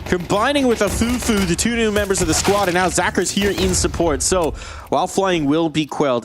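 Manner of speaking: high, excited esports call that settles down; live play-by-play. Acted. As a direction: call the action as it happens. Live casting the round.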